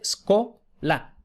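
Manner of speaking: scola split as sco, then la; clear syllable division. Shown as a demonstration. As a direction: divide scola into sco-la, no vowel first. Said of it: "la silabación es claramente".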